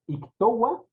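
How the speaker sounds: ictoa said as ik-TO-wa; w glide. Shown as a demonstration. Teacher comparing two forms, ictoa with a w glide. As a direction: put a w between o and a in ictoa. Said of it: "suena como una W".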